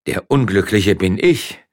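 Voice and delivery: loud, slow groan of self-pity; groaning misery. Acted. Miller fighting shameful tears, groaning in misery. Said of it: "stöhnte gleichzeitig Gevatter Lukas".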